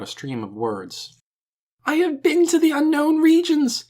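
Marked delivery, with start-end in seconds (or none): 1.8-3.8 loud, high-pitched frantic wail. A narrator's reading. Man raving to a crowd from the hall steps, high wailing. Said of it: "In a high, rapid voice, he wailed out".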